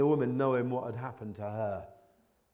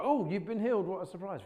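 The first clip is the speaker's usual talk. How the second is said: mock surprise; 9 semitones higher, 5 dB louder than usual. Mocking his own shock.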